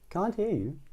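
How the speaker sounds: high, soft sing-out; pretending not to hear. A narrator's reading. Missus Crumb teasing roaring Mister Noisy, pretending not to hear. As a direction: claim deafness in a lifted, soft voice. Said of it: "pretending not to hear".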